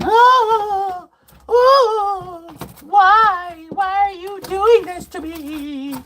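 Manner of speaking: high, wailing fake sob; mock crying. Girlfriend caught cheating, mocked with high fake wailing. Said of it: "then she starts crying".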